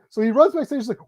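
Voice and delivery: ordinary delivery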